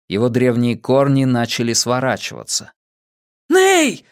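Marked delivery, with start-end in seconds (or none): 3.5-4.0 high-pitched shout of protest; shocked cry. A narrator's reading. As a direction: cry out in alarm, much higher. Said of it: "закричал один из дрюскелей".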